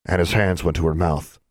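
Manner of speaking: usual speaking voice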